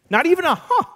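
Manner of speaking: squeaky high little huh; high squeaked mock gasp. Mimics her non-reaction.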